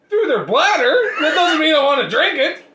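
high, loud, laughing disbelief; outraged but cracking up; laughing disgusted shout. Real, not acted. Disgusted by filtered penguin urine.

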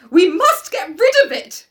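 high, loud, insistent demand; crying out. A narrator's reading. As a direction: cry out the demand as the sister. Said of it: "cried the sister again".

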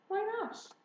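high "Why not?" at everyday volume; puzzled question. Acted. Daughter asks father for reason.

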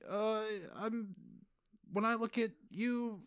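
Tobey Maguire impression. Parody of Peter's awkward speech to MJ, high and halting. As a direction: ham up an awkward, stumbling love speech. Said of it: "Tobey Maguire's weird speech of".

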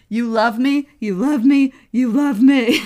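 mock-melodrama, swelling each time; hammed-up lovesick plea; dramatic building declaration. Dramatic repeated line, building.

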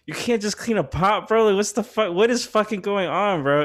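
high, disbelieving scold; scolding the roommate over the pot, high and exasperated; retold exasperated rant. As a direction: raise the pitch, incredulous and fed up. Angry quote at roommate.

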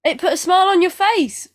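high, loud incredulous question; mock surprise. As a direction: tease him with disbelief. Teasing disbelief.

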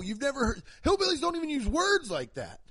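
ordinary talk, nothing marked

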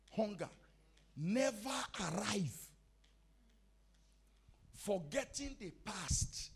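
rising and falling sermon cadence with loud peaks; full preaching. Acted. Preacher urging the congregation to stay hungry, full force. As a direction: preach it home to the church.

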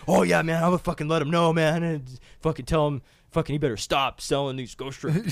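over-eager Mike. Retold or imitated. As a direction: overdo the cooperation, loudly. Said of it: "And Mike's like".

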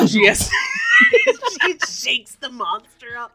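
shrieking with laughter, words spilling out; can barely get it out for laughing; laughing hard. Real, not acted. Cracking up telling the scene.

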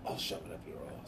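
hushed low British threat; very soft low menace; Statham impression. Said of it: "Why does he say".

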